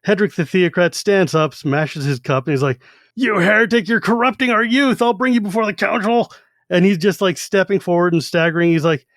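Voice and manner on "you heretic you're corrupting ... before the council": high, ranting heretic accusation; mock angry accusation. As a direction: rant at them like a drunk zealot. Drunk theocrat accuses heretics.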